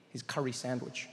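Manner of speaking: ordinary talk, nothing marked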